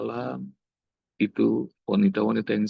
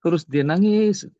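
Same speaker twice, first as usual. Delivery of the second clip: emphatic preaching; 7 semitones higher, 6 dB louder than usual. Real, not acted. Climax of retold story, loud.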